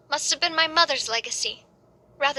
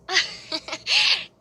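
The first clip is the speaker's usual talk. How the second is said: a light, amused laugh; fond chuckle; 4 semitones higher than usual. Acted. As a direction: laugh fondly at the memory. Fond childhood memory.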